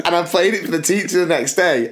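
ordinary talk, nothing marked

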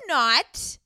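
high, emphatic protest, slow at the end; mock outrage. Real, not acted. Sister defending her favourite host against criticism, high and emphatic. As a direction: playfully defend Guy Raz, high and slow.

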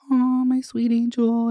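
high, doting coo to her cat; sweet high baby talk to a beloved cat; tender baby talk. Retold or imitated. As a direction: coo it up high like greeting a pet. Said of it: "I'm just like".

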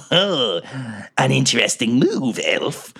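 slow, sly monster drawl; a lightly voiced sly monster; beholder voice. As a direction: savor each word like a gloating beholder. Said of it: "giant-eyed monster cackle and be like".

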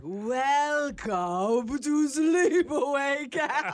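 high, very slow, eerie crying tone; creepy horror voice. Acted. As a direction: drag it out like a horror film ghost. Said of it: "She's a pro".

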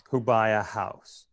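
ordinary talk, nothing marked

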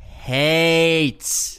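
Hates drawn out long and loud; emphatic repeat. Acted. Insisting to his cohost, with a loud drawn-out repeat. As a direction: lean hard on the word Hates. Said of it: "one thing to say".

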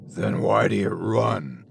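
low, slow, soft growl; soft growling deep voice. Acted. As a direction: rumble it from a dark doorway. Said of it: "A soft, growling voice, deeper than anything".

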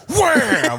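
a high-pitched mock yell of "Wham!"; imitating dad's yell. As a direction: blurt it out high like a dad mid-dunk. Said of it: "he was just going".

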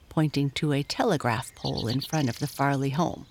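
usual speaking voice

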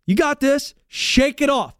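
rally a player, each phrase set apart; empowering coach. Acted. Coach to player after a bad play, rallying and empowering. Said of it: "The coach says".

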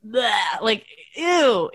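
exaggerated noise of distaste; mock disgust. Wife reacting to posed Facebook photos, playful disgust. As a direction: recoil from it with a playful ew.

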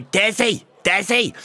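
high mock heckle; mock calling out. Pub hecklers call to Desi, mock calling out.

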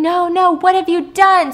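high, loud cry of despair, imitated; retold scream. Host retells the villain watching his angel burn, screaming. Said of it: "He's screaming".

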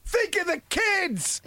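high, slow mock plea; mock pleading call. Calling after Phil out the door, a hammed-up high plea. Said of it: "and I go".

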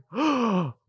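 sharp mock gasp; shocked gasp. Host hears mom yelling at the dog, mock gasp. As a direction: suck in air in pretend shock.

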